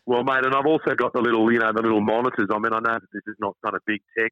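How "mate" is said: normal talking voice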